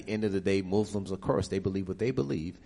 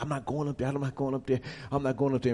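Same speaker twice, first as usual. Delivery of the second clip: higher, quick repeated refusal; stubborn refusal; 5 semitones higher, faster than usual. Retold or imitated. Man resisting the altar call, imitated stubborn refusal. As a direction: dig your heels in, imitating resistance.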